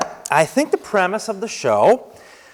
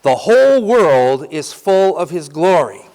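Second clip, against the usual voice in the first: louder proclamation; call for repetition; 5 dB louder than usual. Shown as a demonstration. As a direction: call it out for them to echo. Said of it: "here's the second half".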